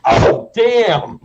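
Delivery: loud, high startled cry; imitated shocked exclamation; startled shoppers. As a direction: jolt as if yelled at, imitating bystanders. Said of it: "And they're like".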